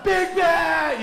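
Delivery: high hammed-up yell; mock shout. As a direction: bellow it like a cartoon villain. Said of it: "It's like".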